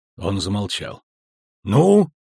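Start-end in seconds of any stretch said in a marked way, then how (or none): 1.6-2.1 louder, high-pitched gruff prompt; grumbled prompt. A narrator's reading. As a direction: demand an answer with one curt word. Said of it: "буркнул Ярпин". (ворчливо и требовательно)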